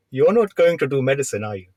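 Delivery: light imitation of an Indian registrar's accent; a hint of an Indian accent, kindly. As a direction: gently voice the Indian reg in his accent. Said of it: "you're doing the accent as well".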